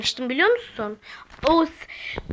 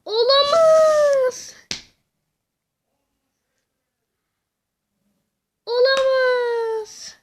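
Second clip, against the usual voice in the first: slow, drawn-out, higher disbelief; dismayed; 7 semitones higher, 4 dB louder, much slower than usual. Real, not acted. Gamer loses her run by accident, slow dismayed disbelief. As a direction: stretch olamaz out in disbelief. Losing progress by mistake.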